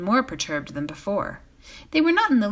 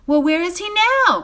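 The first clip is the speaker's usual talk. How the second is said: high, louder clamouring voices; crowd crying out; 12 semitones higher, 8 dB louder than usual. A narrator's reading. Bree folk demanding where Underhill went, loud cry. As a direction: shout it as several bar patrons.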